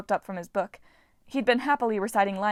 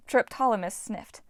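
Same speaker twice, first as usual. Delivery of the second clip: ordinary delivery, nothing marked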